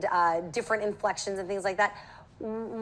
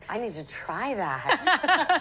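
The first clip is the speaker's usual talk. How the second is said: slow, airy words on the outbreath; exhaling while speaking; much slower than usual. Shown as a demonstration. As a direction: let the breath flow out as you speak. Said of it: "by exhaling while we're speaking".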